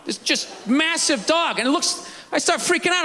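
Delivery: ordinary delivery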